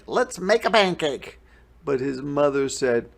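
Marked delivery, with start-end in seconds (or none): 0.1-1.4 put-on high, loud little boy voice; eager boy. A narrator's reading. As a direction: beg like an excited kid. Jack voiced in story.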